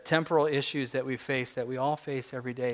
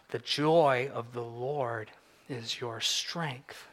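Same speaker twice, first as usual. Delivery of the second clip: each word of the verse drawn out slowly; a reverent, unhurried "The joy of the Lord is your strength"; slow savoring; 2 semitones lower, much slower than usual. Shown as a demonstration. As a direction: let the verse land slowly, like a discovery. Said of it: "this moment of discovery".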